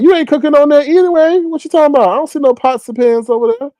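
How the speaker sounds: high, playful mock accusation; teasing. Real, not acted. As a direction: rib her about the empty kitchen. Teasing Chef Austin in chat.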